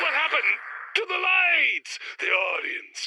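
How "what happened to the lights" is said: alarmed. Acted. Lights go out suddenly.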